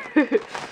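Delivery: small amused giggle; light laugh. Real, not acted. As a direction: laugh briefly after a playful plea. Laughs after joking plea.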